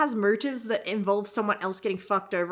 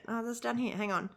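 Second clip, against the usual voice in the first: ordinary delivery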